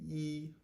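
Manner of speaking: E as "ee", high and level; letter-name E. Shown as a demonstration. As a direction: hold E on one high, steady note. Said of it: "the name of the letter E".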